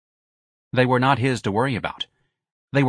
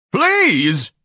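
high, loud desperate plea; crying out; 11 semitones higher, 6 dB louder than usual. A narrator's reading. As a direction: cry out for mercy. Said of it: "Ignatius cried".

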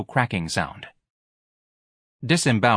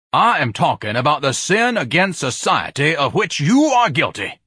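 loud, raised denunciation; indignant accusation; 7 semitones higher, 5 dB louder than usual. Acted. Ignatius charging the manager with sin against society, indignant. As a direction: declaim the charge loudly.

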